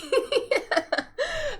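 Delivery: high laugh, falling away; real laugh. Real, not acted. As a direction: burst out laughing at the line. Laughs at lyric.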